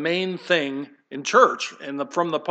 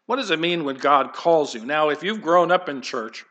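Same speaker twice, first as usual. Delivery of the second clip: ordinary talk, nothing marked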